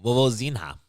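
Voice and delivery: Vovozinha said the Portuguese way, vovo-ZEE-nya; Portuguese pronunciation. Shown as a demonstration. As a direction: say Vovozinha like a Brazilian. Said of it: "so how would you say that?".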